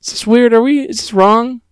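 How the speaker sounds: high-pitched, put-on hesitant questioning; mock unease. Host retelling two characters doubting themselves, mock uneasy. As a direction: question it warily, pitched well up. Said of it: "And they're like".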